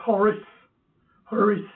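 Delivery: charis with an H-like first sound; pronunciation demo. Shown as a demonstration. Preacher teaching the Greek word for grace, pronunciation demo. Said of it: "And it's pronounced".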